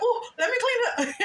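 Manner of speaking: high eager voice breaking into a laugh; laughing self-mockery. Mother rushing to her kids' mess, laughing self-mockery. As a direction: play the doting helper, high and laughing. Mocks her own doting on kids.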